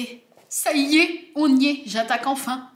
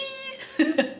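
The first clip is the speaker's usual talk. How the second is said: giddy laugh. Real, not acted. Laughs after revealing her choice.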